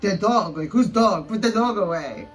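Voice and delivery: hammed-up Russian accent for a retold quote; mock Russian accent. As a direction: imitate a rude man with a put-on Russian accent. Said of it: "I make everyone's Russian accent".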